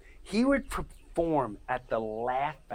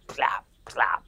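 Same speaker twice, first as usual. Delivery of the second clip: plop sounds; 4 semitones lower than usual. Pretend. Mimicking bodies dropping, whispered.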